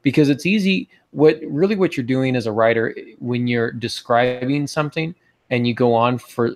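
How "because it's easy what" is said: ordinary delivery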